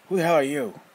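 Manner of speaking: put-on boy demanding who the stranger is, loud and defiant. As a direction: snap back at the stranger.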